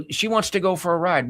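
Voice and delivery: ordinary delivery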